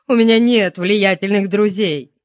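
громче и выше, с отчаянием; оправдывайся, умоляя о сочувствии; жалобная мольба. A narrator's reading. Управляющий жалобно оправдывается перед допрашивающими. (отчаянно жалуясь господам)

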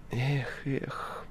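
soft, disappointed sighing groan; wincing displeasure. A narrator's reading. Guest hears the poet's surname, wincing displeasure. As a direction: let it out like a quiet wince. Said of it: "сказал гость, морщась".

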